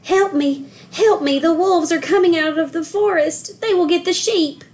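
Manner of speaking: high, urgent cry for help; panicked calling while running; shouting. A narrator's reading. Terrified Joseph runs to the village, frightened shouting. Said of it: "He ran down the hillside shouting".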